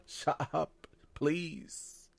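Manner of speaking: pleading quietly with Tabitha not to wake the monsters; hushed plea. Retold or imitated. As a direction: beg her under your breath to be quiet.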